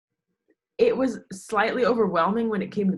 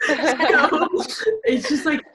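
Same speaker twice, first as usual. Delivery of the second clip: high, loud laugh through the words; 10 semitones higher, 7 dB louder, much slower than usual. Real, not acted. Laughing at her own joke to the interviewer. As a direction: giggle the words out.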